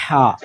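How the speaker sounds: ہا from the throat with a breathy catch; throaty ha. Shown as a demonstration. Teacher demonstrating a throaty ہا to students. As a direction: push ہا out from the throat. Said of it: "یہ آپ کے حلق سے آواز نکلے گی، بڑی بریک سی".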